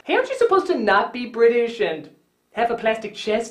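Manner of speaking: normal talking voice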